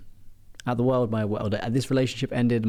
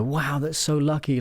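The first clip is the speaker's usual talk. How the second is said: higher, drawn-out mild wonder; impressed villagers; 6 semitones higher than usual. Acted. Villagers to the farmer about his new horse, impressed. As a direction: lightly act out amazed villagers.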